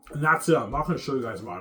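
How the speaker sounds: ordinary talk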